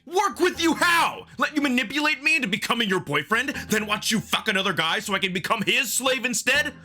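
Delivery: high, bitter rant rising to a near shout; words spilling out in wounded rage; furious tirade. Acted. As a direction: lash out to hurt him. I can't stop the words from spilling out.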